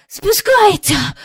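high, slow and out of breath; breathless. Acted. Michael after pulling hard, breathless high cry. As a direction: gasp it out after the effort. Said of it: "засапано сказав Майкл".

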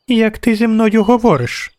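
put-on high-pitched child princess voice; haughty little girl. A narrator's reading. Little princess rebukes the witcher, haughty high voice. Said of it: "Дівчинка гордовито задерла голову".

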